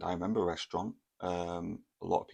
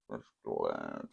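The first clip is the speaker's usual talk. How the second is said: slow ominous; 13 semitones lower, 4 dB quieter than usual. Retold or imitated. Repeated for effect.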